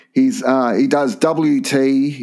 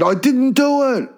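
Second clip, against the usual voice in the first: much higher, whiny mock voice; Jarryd Hayne mocked; 11 semitones higher than usual. Player mocked while taken to jail, much higher whiny denial. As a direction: ham up a pleading denial. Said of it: "He said".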